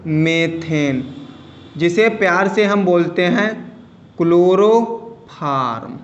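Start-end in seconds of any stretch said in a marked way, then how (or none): 4.2-6.0 drawn out slowly. Shown as a demonstration. Teacher reveals the common name.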